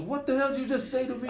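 high, fast mock outrage; mock shocked. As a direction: act stunned, half laughing. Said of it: "He goes".

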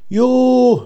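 very high, drawn panicked cry; shrill wail. A narrator's reading. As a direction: cry out high in terror, acted. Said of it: "diye tiz bir feryat". (tiz bir feryatla)

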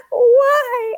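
high, loud wailing why; heartbroken child. Retold or imitated. Nephew wails at his mom for taking toys. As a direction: cry out like a devastated little boy. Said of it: "he was just like".

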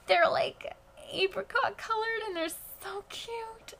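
melting over how cute they are; gushing over puppies. Real, not acted.

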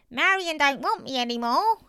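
put-on high, loud old man's complaint; Mister Braithwaite. A narrator's reading. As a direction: complain as an aggrieved elderly father. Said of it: "said Mister Braithwaite".

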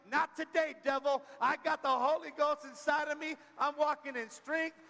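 lightly voiced, bold declaration to the devil; defiant believer. Believer telling the devil off, defiant. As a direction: stand tall and rebuke the devil. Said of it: "you'll be like".

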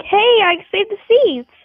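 perky, high-pitched mock greeting; excited greeting. Game host voicing a date so excited to see you, excited. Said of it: "she's so excited".

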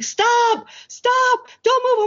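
retold friends yelling up at a climber. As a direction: call up the wall to stop her, high and urgent. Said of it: "my friends all start yelling".

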